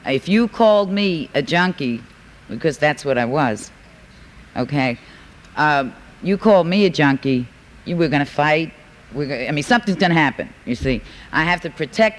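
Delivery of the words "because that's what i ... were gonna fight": normal talking voice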